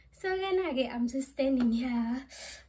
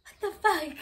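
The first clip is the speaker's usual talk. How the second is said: shocked; 6 semitones higher, 3 dB louder than usual. Retold or imitated. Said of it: "And I'm like".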